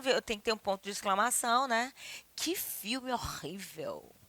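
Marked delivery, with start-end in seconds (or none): 2.4-4.1 slow, soft, falling disgust; hushed, lingering disapproval; exclamation of dismay. Shown as a demonstration. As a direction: draw out the dismay quietly. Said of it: "o emissor externa um estado afetivo".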